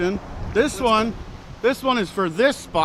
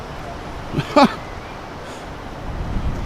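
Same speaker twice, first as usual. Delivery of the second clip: scoffing laugh; 6 semitones higher, 7 dB quieter than usual. Real, not acted. Scoffing during the argument.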